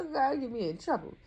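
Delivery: ordinary delivery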